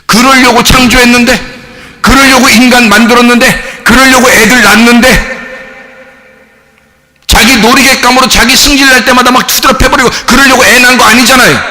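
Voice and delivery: high, loud, impassioned sermon delivery; rising pulpit fervor; fiery preaching. Acted. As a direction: preach it full force to the congregation. Preaching at full force.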